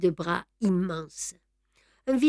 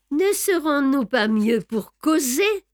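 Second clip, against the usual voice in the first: higher-pitched rhetorical question; quick retort; 6 semitones higher than usual. A narrator's reading. Clerk answering Beautrelet about the locked door, retorting. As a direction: toss the question back as a retort. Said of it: "riposta Brédoux".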